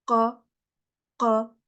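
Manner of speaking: ordinary delivery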